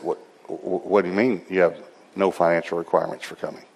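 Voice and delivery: low, halting, quiet disbelief; stunned confusion. Retold or imitated. Pastor thrown by a no-fee answer, halting and confused. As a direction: stammer softly as if thrown.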